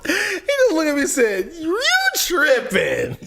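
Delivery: high, laughing and half crying; laughing hard. Real, not acted. Telling friends about the fight, laughing high. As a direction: laugh helplessly through it.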